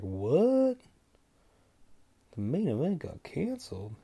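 stunned, drawn-out question back; incredulous. Retold or imitated. Fan hearing from his buddy that the fight is off, incredulous. Shocked reaction to buddy's news.

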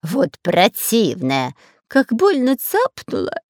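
slow, whining complaint; complaining. Acted. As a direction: moan about the bite. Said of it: "жаловался шпион Дырка".

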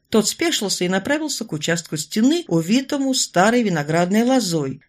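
ordinary delivery

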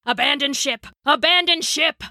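captain's alarm call, kept in check; mildly projected storyteller's bellow. A narrator's reading. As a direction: order the crew off, building on the repeat. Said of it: "Uncle Rashid was bellowing".

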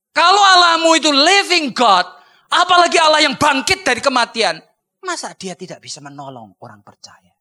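loud high fervent preaching. Acted. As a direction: thunder it from the pulpit. Preaching climax.

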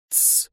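sharp unvoiced hiss for silence; hissed shush. A narrator's reading. Mark hushing the shouting girls, sharp hiss. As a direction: hiss them quiet. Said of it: "зашипел я".

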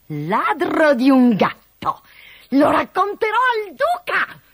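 loud, high-pitched outraged cook; angry shout. A narrator's reading. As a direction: shriek it while chasing a thief. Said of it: "gridò".

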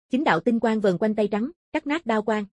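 usual speaking voice

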